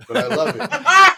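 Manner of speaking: high, loud laugh through the words; real laughing admission. Real, not acted. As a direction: burst out laughing on the confession. Admits loving a trash film.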